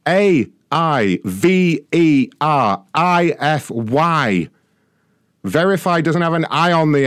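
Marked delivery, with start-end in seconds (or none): 0.1-4.5 letter by letter, very slow; slow spelling out. Shown as a demonstration. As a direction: spell it slowly and pointedly. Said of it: "I spelled it to you several times".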